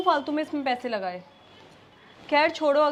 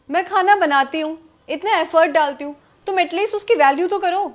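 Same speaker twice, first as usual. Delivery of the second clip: raised, indignant voice; angry protest; 6 dB louder than usual. Acted. Wife tells husband to value her cooking, angry. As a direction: protest loudly, hurt and fed up.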